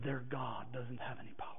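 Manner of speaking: unvoiced, soft, secretive; hushed whisper. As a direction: breathe it like a dark secret. Coworker's hushed final verdict.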